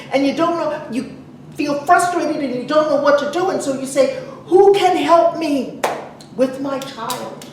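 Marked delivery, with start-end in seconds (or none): none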